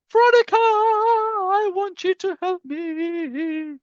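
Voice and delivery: high, slow, drawn-out put-on plea; mock pleading voice. Host calling on student Veronica for help: high, slow mock plea. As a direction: whine it up high like a needy cartoon.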